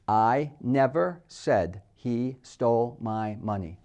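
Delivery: slow, measured delivery of each word. Shown as a demonstration. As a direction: separate every word with a small pause. Sentence repeated slowly, word by word.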